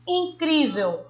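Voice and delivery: teacherly in-CRÍ-vel, middle syllable emphasized; stress on the middle syllable. Shown as a demonstration. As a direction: lift the CRÍ in incrível, then fall. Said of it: "O cri a gente pronuncia com mais intensidade".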